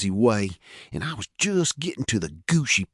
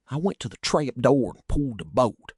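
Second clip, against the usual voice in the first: ordinary delivery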